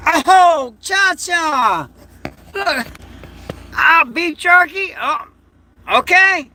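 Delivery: high, excited coo at the dog; pet talk. Real, not acted. Owner cooing to his dog Cha-Cha, high and delighted.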